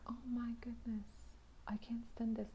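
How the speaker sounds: unvoiced whisper of despair. Sitting in the convention hall, whispered despair. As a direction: breathe it out, trapped and miserable. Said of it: "I was like".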